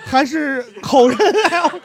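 very slow, high, laughing; slow laughing question. Real, not acted. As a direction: drag it out with a laugh. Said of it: "joking character breakdown".

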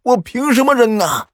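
high, loud yells between father and son; shouting quarrel. A narrator's reading. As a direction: shout back and forth while running. (父子俩边跑边大声争吵)

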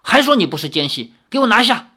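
先急促质问，再猛地拔高音量喊出"给我拿下"; 像队长下令抓人那样厉声高喊; 大声喝令. A narrator's reading. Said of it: "大声说". (队长认定对方说谎，大声下令拿人)

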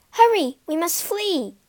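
urging. A narrator's reading. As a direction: press him to hurry. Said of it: "urged Asta".

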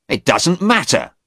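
high, agitated dismissal; angry. A narrator's reading. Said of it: "Stephen said angrily".

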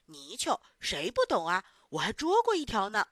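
loud boastful bluff; pretending to know. A narrator's reading. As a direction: bluff like a child who knows it all. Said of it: "却装作什么都懂的样子说".

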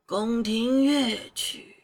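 put-on slow, wistful old man; drawn-out old sage reply; wise old sage. A narrator's reading. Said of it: "才缓缓开口道".

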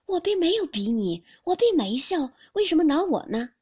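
loud, high, aggrieved denial; urgent protest. A narrator's reading. 宝玉急着向黛玉辩白. (委屈地高声辩解)

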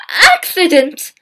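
high, loud outburst; cried out. Acted. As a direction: shout the word in disbelief. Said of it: "Diane cried".

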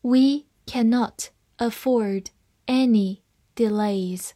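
slow, with pauses between words; deliberate teaching pace; slow reading. Shown as a demonstration. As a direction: read carefully word by word for learners. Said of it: "好，慢慢来读".

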